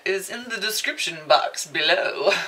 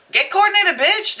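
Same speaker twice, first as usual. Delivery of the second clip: high, loud sassy jab; 13 semitones higher, 8 dB louder than usual. Real, not acted.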